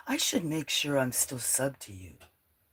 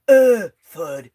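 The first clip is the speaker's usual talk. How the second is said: loud, high imitated crash; mock thud sound; 5 semitones higher, 16 dB louder than usual. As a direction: act out a loud fall sound. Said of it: "you'd hear".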